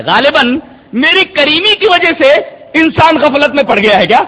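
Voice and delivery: normal talking voice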